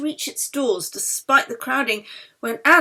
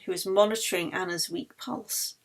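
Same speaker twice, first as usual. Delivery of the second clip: usual speaking voice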